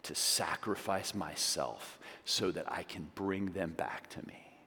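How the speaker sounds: a hushed, longing promise; tender God voice. Pretend. God speaking of his people, hushed and tender. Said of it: "He saw it and he said".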